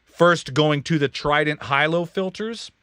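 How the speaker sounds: ordinary talk, nothing marked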